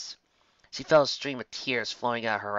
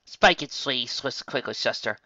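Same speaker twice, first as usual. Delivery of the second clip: ordinary delivery, nothing marked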